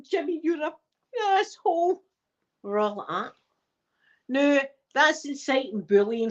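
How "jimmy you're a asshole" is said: higher mocking taunt; kids taunting Jimmy. Classmates blaming Jimmy, high mocking taunt. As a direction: taunt him like a playground mob. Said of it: "we're all going".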